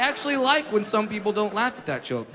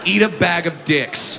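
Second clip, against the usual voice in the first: slow, deliberate, louder put-down; slow contemptuous insult; 3 semitones lower, 4 dB louder, slower than usual. Real, not acted. Comic telling offended people off. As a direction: spit it out with contempt.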